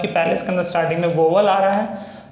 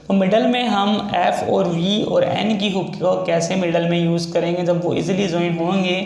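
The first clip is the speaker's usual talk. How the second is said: ordinary talk, nothing marked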